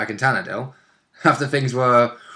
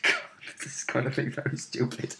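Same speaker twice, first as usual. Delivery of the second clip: dread murmur; 13 dB quieter than usual. Retold or imitated. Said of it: "So I was like".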